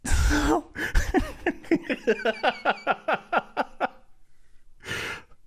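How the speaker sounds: high, drawn-out laughter; long laugh. Real, not acted. Laughing at villain's look.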